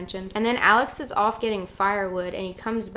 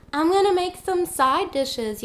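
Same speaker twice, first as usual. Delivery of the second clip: mock girlfriend, pitched up and slow; voz aguda e lenta de namorada, imitada; high girlfriend voice; 9 semitones higher, 4 dB louder than usual. Girlfriend voiced, offering side dishes.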